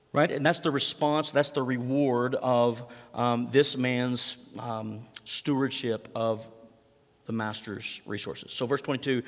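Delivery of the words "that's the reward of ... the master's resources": very slow, drawn-out phrasing; slow emphasis. Real, not acted. Preacher stresses stewardship, very slow. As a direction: lay each word down slowly.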